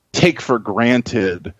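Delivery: ordinary talk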